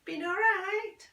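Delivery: high, slow and probing; mother's voice. Acted. Mother checking on son, high and slow. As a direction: lift the voice into the mother asking.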